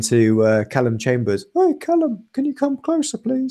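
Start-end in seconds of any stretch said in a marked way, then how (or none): 1.5-3.5 very high, squeaky mock Spanish accent; squeaky David Ospina Spanish accent. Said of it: "his probably squeaky little Spanish accent".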